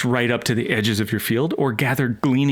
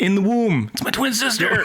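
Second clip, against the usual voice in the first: mock awkward answer; 8 semitones higher than usual. Jokey answer as the embarrassed husband.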